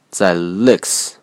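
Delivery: ordinary talk, nothing marked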